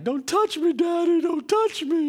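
put-on a teen girl wailing at her dad, pitched high; upset daughter. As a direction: play the crying daughter pushing dad away, high and loud.

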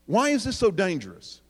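ordinary delivery

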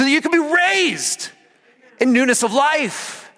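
rising sermon shout; full-force preaching. Acted. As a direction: preach it out to the whole room.

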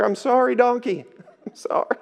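high mock apology dissolving into a laugh; sheepish apology. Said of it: "and he's like".

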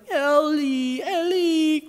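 put-on high sing-song little kid calling; toddler voice. Toddler calling for his lovey elephant, high and sing-song. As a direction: coo it like a sleepy toddler. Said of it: "He's like".

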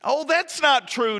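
high, loud, slow denial; protesting. Acted. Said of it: "Jonathan protested".

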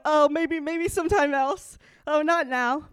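mock reluctant Jesus turning the leper away; dismissive Jesus. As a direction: brush them off lightly, mocking. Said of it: "But he goes".

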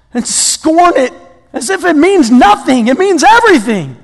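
pleading exasperation, pitch leaping up on the short answers; exasperated. Acted. Preacher as Paul pleading not to scorn the cross, exasperated. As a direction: implore them in dismayed disbelief. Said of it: "And Paul's exasperated".

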